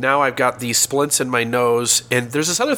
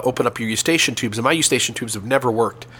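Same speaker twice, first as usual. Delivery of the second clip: ordinary talk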